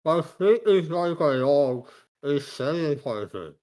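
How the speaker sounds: speaking through a stuffed hand; muffled through a hand. Acted. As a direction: mumble it past the hand. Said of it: "I'll say through, like, the stuffed hand".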